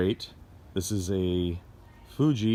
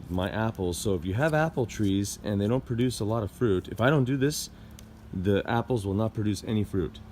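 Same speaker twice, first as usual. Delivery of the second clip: ordinary talk, nothing marked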